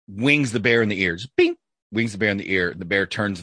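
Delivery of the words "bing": high little "bing" sound effect; a quick, high-pitched ping; imitated ricochet ping. Telling a hunting joke, imitated bullet ping. Imitates the shot nicking the ear.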